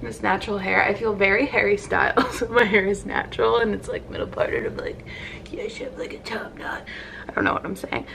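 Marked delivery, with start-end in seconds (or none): 3.8-8.1 whispering under her breath; hushed sheepish whisper; whispered aside. Real, not acted. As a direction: drop to a whisper, half to herself.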